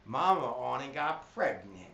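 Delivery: silly mnemonic delivery; cray-cray voice. Shown as a demonstration. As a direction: ham it up so kids remember. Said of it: "saying it in a way where I'm trying to get kids to remember".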